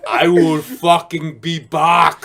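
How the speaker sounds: slow, menacing mock Austrian accent; Arnold Schwarzenegger. Star glaring at his imitator, slow mock-Austrian threat. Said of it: "he's just looking at me like".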